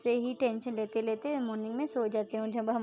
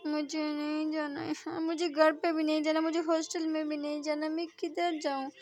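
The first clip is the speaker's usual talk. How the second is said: high, sulky, put-on whine; a girl whining she has nowhere to go; whiny complaint; 5 semitones higher than usual. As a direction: whine like a stuck child. Whiny complaint after laugh.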